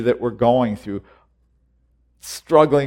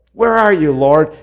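loud, high cry for the Lord; calling out; 6 semitones higher, 9 dB louder than usual. Pretend. Believer crying out for the Lord, loud and high. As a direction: call out searching for him. Repeated plea.

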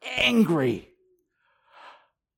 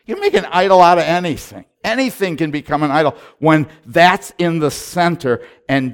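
louder, higher, rising fervor; sermon refrain building louder and higher; building preaching; 5 semitones higher, 6 dB louder than usual. Acted. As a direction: preach it, building with each line.

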